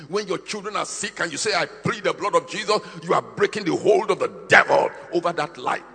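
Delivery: high, loud pulpit declaration; full-force preaching. Acted. Preacher pleading the blood.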